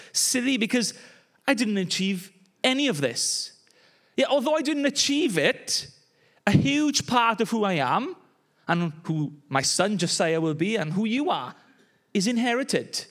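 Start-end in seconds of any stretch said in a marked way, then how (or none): none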